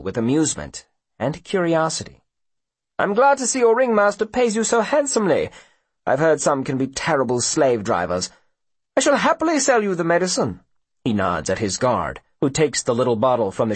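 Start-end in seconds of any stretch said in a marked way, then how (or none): 3.0-10.6 high, swooping mock politeness; condescending gentleman with theatrical relish; smug rich villain. A narrator's reading. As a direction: purr false compliments in a lofty high voice. Voices smug Mister Peters.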